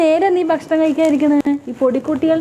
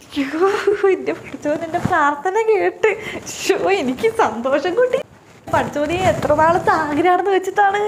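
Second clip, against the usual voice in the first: a little higher, happy and grateful; mother-in-law thanking God for her prayer being answered, happy and higher; joyful thanks; 4 semitones higher than usual. Acted. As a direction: bless God with delight.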